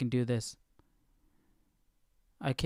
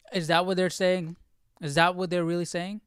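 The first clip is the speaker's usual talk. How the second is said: higher and louder, can't believe what she's reading; disbelieving surprise; 5 semitones higher, 5 dB louder than usual. Real, not acted. Reading a Bible verse, stunned by its meaning, disbelieving surprise. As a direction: ask it with real shock, half laughing.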